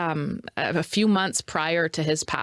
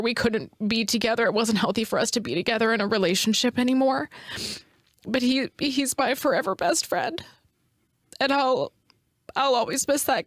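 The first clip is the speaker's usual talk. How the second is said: tearful, voice pitched up with grief; real crying; 6 semitones higher than usual. Real, not acted. Grieving woman remembering her best friend on air, crying. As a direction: choke through it in halting, high phrases. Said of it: "Sorry for crying".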